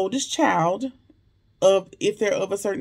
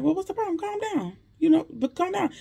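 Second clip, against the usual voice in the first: high mock Sabrina; 9 semitones higher than usual. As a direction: coo it up high to calm him down. Sabrina voiced in recap.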